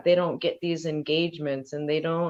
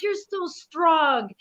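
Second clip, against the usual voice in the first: much higher, slow and admiring; praising; 10 semitones higher, slower than usual. Retold or imitated. Auntie praising her niece, high and slow. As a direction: tell a toddler she's mighty.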